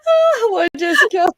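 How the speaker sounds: put-on very high, loud old lady greeting. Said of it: "That was my old lady voice".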